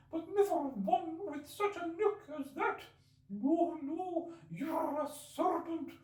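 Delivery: very high, slow bird voice full of scorn; contemptuous pigeon. Acted. Pigeon scoffing at Alice, contemptuous.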